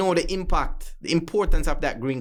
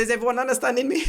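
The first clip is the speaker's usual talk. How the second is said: retold question to jury; 8 semitones higher, faster than usual. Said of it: "I said".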